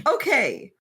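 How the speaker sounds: normal talking voice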